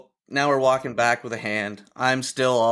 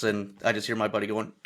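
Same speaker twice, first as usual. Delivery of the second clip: normal talking voice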